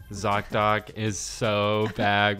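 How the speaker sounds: usual speaking voice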